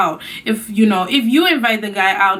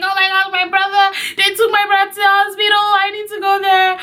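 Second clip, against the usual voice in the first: higher, sing-song tearful excuse; tearful plea; 10 semitones higher than usual. Retold or imitated. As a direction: beg to leave through tears. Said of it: "I'm like".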